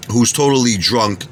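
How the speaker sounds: usual speaking voice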